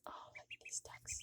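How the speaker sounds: soft unvoiced whisper of adoration. Real, not acted. Owner whispering lovingly over her ducks, hushed. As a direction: breathe the words to the ducklings.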